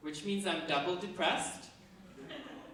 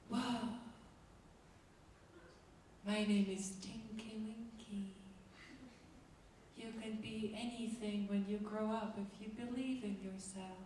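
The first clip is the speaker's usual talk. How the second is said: put-on soft, gentle children's TV voice, a bit softer; Teletubby voice; 4 semitones higher, 10 dB quieter than usual. Teletubby greeting the children, soft and gentle. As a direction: coo it like a cheerful kids' show character. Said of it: "Teletubbies are like".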